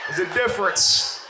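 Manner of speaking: ordinary talk, nothing marked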